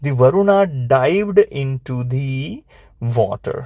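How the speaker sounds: slow words, pause before the last; slow dictation. Shown as a demonstration. Teacher dictating Varuna's dive to pupils, slow dictation. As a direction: dictate it steadily, leaving time to write.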